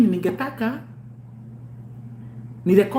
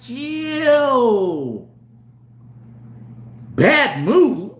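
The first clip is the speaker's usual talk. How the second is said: cool dismissal; 4 semitones higher, much slower than usual. Retold or imitated. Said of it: "I'm like".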